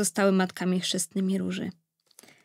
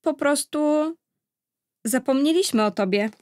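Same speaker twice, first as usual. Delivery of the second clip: hesitant apology, breaking off midway; stammering; 6 semitones higher than usual. A narrator's reading. King admitting to the evil fairy they forgot, faltering. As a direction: falter through the excuse. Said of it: "wyjąkał król".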